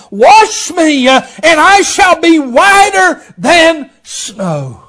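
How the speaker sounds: high, loud, slowing on the psalm verses; full-force preaching. Acted. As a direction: call the verses out to the church.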